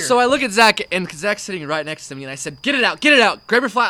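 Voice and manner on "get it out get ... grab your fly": high, loud, urgent repeats; screamed commands. Retold or imitated. Telling Zach to get the bug out, yelled commands. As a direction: re-enact yelling frantic orders. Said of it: "I was just screaming commands".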